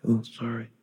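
small, soft sorry; quiet, awkward murmur; sheepish apology. Acted. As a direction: apologise meekly as you squeeze past. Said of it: "they're walking by like".

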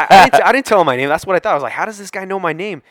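loud, high-pitched comic bafflement; incredulous surprise. Retold or imitated. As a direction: pitch it way up in retold disbelief. Retelling bafflement, with listeners laughing.

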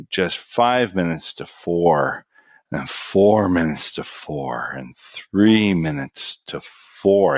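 slow, heavy counting of the minutes; dragging slowly. Shown as a demonstration. Kid in detention watching the clock, slow and drawn-out. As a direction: let each minute crawl by. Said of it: "watching this clock just drag".

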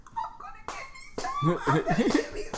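high giddy mock excitement; excited. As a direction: squeal it out gleefully. Quoting her own excitement.

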